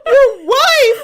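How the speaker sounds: high, loud hammed-up cry; mock wail. Wife reminding husband of marriage, mock hysterical. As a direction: imitate a hysterical wife at the top of the range. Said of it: "And I'm like".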